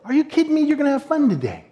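high-voiced mock incredulity; upbeat disbelief. Friend's upbeat reply, retold.

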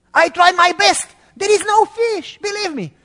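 high, loud pleading fisherman; Peter pleading. Acted. Peter pleads with Jesus that there are no fish, high and loud. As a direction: beg him to believe you, voice up high.

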